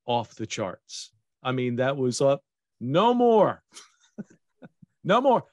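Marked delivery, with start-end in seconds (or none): 2.8-5.4 higher, louder, mock exasperated no more; mock fed-up cry. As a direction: cry it out like you've had enough. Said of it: "I've had it".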